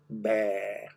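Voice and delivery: ordinary delivery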